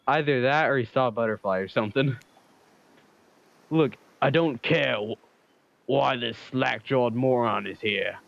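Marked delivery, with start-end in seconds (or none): 3.7-8.2 put-on slow, overdone British accent. Killer dismissing the newcomer in a hammed-up British accent. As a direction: sneer it in a heavy British accent. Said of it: "the most U K accent I have".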